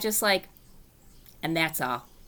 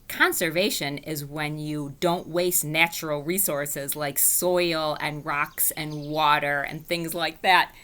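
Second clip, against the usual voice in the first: plain reading voice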